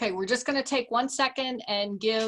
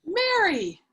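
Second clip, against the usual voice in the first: high, loud reproving call of a name; sharp call; 9 semitones higher, 6 dB louder than usual. Acted. Mother scolding Mary for rudeness to her suitor. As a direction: snap her name to stop her.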